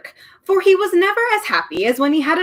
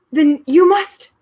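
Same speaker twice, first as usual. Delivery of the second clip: loud high gasp of alarm; 8 semitones higher, 8 dB louder, slower than usual. A narrator's reading. Milo realising the truth, gasping. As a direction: blurt it out in shock. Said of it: "gasped Milo".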